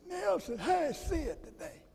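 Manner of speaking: imitating Sterling. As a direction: pitch way up and soft, mimicking the friend. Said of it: "It's the way he talked".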